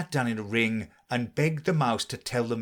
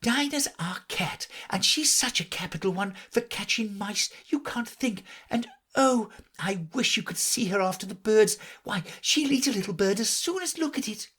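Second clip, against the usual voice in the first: put-on higher girlish voice, eager; 10 semitones higher than usual. A narrator's reading. Alice telling the birds about her cat, eager. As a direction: gush about your pet. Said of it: "Alice replied eagerly".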